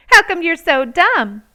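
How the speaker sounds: loud mocking playground call; taunting shout. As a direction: jeer at her loudly across the playground. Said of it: "a boy called out".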